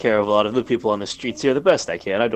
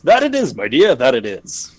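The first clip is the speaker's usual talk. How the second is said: jolly innkeeper; 3 semitones higher, 6 dB louder than usual. Acted. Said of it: "he's like".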